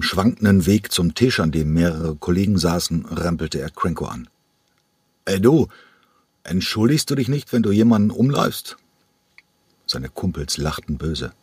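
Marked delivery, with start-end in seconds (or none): 5.2-8.8 höher und provozierend angepöbelt; pöbelnder Betrunkener. Acted. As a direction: wie ein angetrunkener Rüpel, der Streit sucht. Betrunkener rempelt Cranko an. (Betrunkener pöbelt Cranko an, provozierend)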